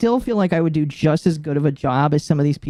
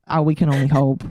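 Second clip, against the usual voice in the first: usual speaking voice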